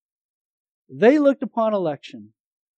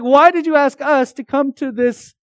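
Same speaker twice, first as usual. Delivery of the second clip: high, loud, exasperated protest; a preacher mimicking his own frustrated outcry; indignant outburst; 7 semitones higher, 6 dB louder than usual. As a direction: throw the complaint back at them, much higher and louder. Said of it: "I was like".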